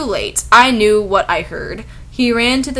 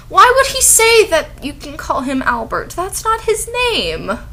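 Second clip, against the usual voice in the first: baffled; 9 semitones higher than usual. Real, not acted. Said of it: "baffled reaction to the text".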